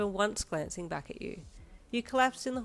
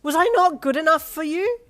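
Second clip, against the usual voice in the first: much higher, louder anguished scream; 11 semitones higher, 15 dB louder than usual. A narrator's reading. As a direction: scream it, heartbroken. Continuing screaming fit.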